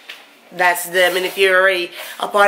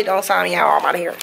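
normal talking voice

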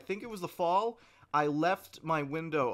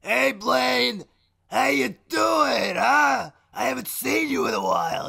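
higher and louder, a brash shouting cousin; put-on cousin voice; 9 semitones higher, 9 dB louder than usual. Prank call to Blaine, higher, louder put-on cousin. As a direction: boom it like a long-lost cousin. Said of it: "the impression I'm doing".

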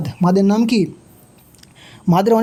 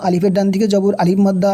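usual speaking voice

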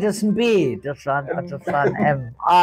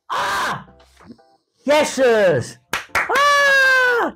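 excited shouting; 12 semitones higher, slower than usual. Real, not acted. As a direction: yelp with triumph. Guessed the word at last.

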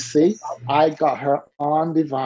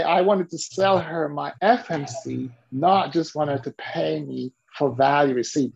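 normal talking voice

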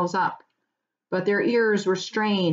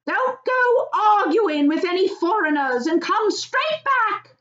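high, loud wifely call from the doorway; farmer's wife calling out; 13 semitones higher, 4 dB louder than usual. Acted. Wife calls her husband off at the door, high fond call. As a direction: call after him, much higher, fond and fussing. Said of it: "she called".

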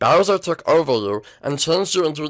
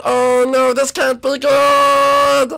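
loud, higher frantic yells; panicked shouting; 9 semitones higher, 4 dB louder than usual. Acted. Hit by the potion, frantic loud yells. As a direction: cry out in alarm.

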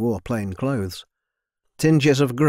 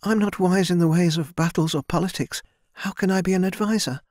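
put-on higher, timid young woman's voice; young servant girl; 8 semitones higher than usual. A narrator's reading. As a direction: voice a shy girl speaking up to her lord. Narrator voices Mina.

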